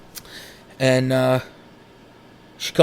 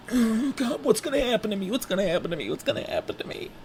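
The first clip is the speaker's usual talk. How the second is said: panic fading; 9 semitones higher, 5 dB quieter, faster than usual. Retold or imitated.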